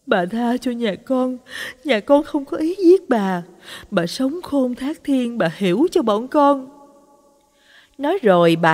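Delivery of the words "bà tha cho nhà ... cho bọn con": higher, frightened pleading to the dead; trembling pleading murmur. A narrator's reading. Wife pleading with the dead woman by the stove, frightened murmur. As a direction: beg the ghost for mercy, pitch lifted. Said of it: "run rẩy lẩm bẩm trong miệng".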